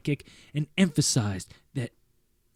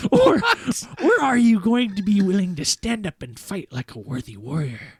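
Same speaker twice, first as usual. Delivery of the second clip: put-on old man voice; 6 semitones higher, 3 dB louder than usual. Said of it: "he sharply asked me".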